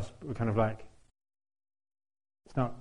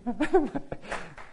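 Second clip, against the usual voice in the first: high laugh; 14 semitones higher than usual. Real, not acted. Laughing at the compliment.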